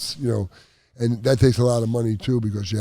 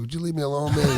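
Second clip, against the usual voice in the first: laughing while brushing him off; laughing retort; 6 semitones higher than usual. Retold or imitated. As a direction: tell him to leave you alone through a laugh. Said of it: "I'm like".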